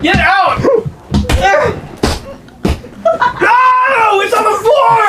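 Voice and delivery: high shrieking dismay; shouting. Real, not acted. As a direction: cry out in real panic. Marshmallow on the floor.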